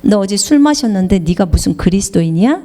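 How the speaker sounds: lightly voiced devil, low and insinuating; whispering devil. The devil accusing a believer of sins, low and insinuating. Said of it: "마귀가 여러분에게 속삭일 거예요".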